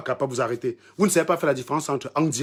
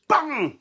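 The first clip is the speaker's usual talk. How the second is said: mock impact bam; 10 semitones higher than usual. Imitates hitting with a club.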